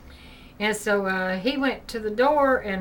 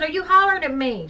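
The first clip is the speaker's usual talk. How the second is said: raised, higher call; calling through a door; 7 semitones higher, 5 dB louder than usual. Retold or imitated. Mother calls to daughter in the shower, higher and louder. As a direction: call out to someone behind a door.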